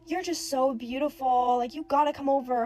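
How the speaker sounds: loud, drawn-out imitation of pestering; retold yelling. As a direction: imitate a loud, insistent admirer, stretching the words. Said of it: "like, yelling, like, telling me".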